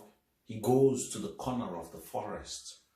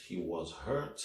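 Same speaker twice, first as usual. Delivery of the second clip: ordinary delivery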